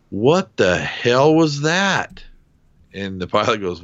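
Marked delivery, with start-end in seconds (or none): 0.1-2.2 high, slow, stunned question; shaken disbelief. Retold or imitated. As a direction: drag it out, still rattled. Shaken gunner confronts the pilot.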